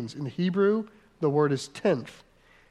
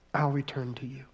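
whispered promise; 4 semitones lower, 7 dB quieter than usual. Acted. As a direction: whisper it gently, unvoiced. God's promise read softly.

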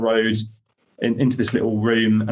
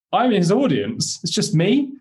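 amazed; 8 semitones higher than usual. Retold or imitated. Amazed retold reaction.

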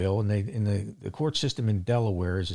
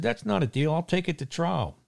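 raised pitch, indignant retelling of telling off the judge; higher-pitched, indignant pushback at the judge; retold angry retort; 7 semitones higher than usual.

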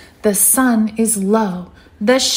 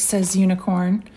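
plain reading voice

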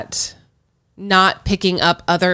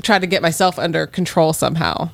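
usual speaking voice

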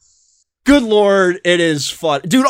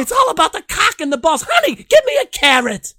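high fast lady voice; hammed-up high housewife; man doing a woman's voice; 7 semitones higher than usual. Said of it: "talking like the lady".

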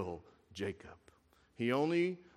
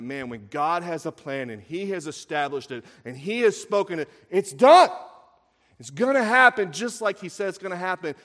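impassioned preaching; 6 semitones higher, 11 dB louder than usual. Acted. Preacher assuring the congregation of God's plan, impassioned. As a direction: build to a loud, high declaration.

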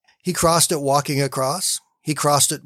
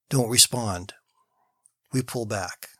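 whispered; 4 semitones lower, 5 dB quieter than usual. Acted. People pulling back from God.

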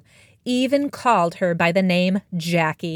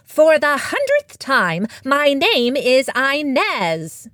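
higher, slow, exasperated cat; insist, fed up, stamping paws; frustrated purr; 6 semitones higher, 3 dB louder, slower than usual. Acted. Cat correcting the farmer about her name, exasperated and slow. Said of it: "Inez purred, stamping her little paws in frustration".

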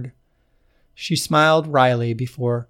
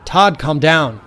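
firm call; 4 semitones higher, 7 dB louder, slower than usual. A narrator's reading. Kate stopping panicking Todd.

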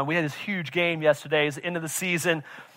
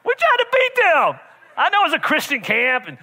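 high, loud, laughing protest; mock outrage; 8 semitones higher, 6 dB louder than usual. Father protesting that they want the win, high and laughing. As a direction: squeal it like a competitive dad.

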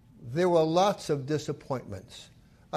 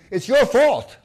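loud, high blaming; it's all your fault, hurled; accusing; 5 semitones higher, 7 dB louder than usual. Acted. Sisters accuse Jesus of letting Lazarus die, loud blame. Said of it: "they were saying".

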